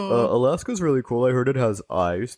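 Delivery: mock teenage essay reader; a hint of a dopey teenager; lightly voiced teen. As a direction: lightly imitate a naive eighteen-year-old. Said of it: "He was, like, eighteen. He's like".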